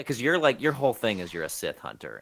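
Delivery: ordinary talk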